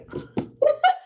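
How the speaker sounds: sudden high laugh. Real, not acted. Friend laughing because she asked the same, high laugh.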